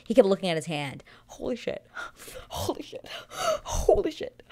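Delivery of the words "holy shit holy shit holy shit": high, breath-filled mock shock; imitated stunned disbelief. Retelling groom staring at his hand, imitated disbelief. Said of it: "he said, "Holy shit," like, five times".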